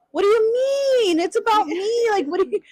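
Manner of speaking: put-on high, protesting child voice; indignant kid. Retold childhood protest to mom.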